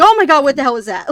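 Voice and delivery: loud, high startled yell. Retold or imitated. Streamer recalling yelling at a horror game, yelling. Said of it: "but just yelling".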